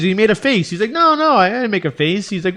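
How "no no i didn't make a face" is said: Larry David. Retold or imitated. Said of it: "him being Larry David".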